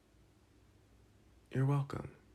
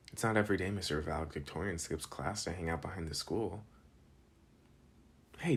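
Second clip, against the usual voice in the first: ordinary talk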